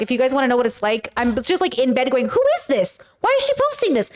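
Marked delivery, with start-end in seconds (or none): 2.3-4.1 very high comic squeak; acting out own bafflement. Showing how they scroll TikTok in bed, squeaky and baffled. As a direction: squeal it in mock disbelief. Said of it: "This is literally me on TikTok".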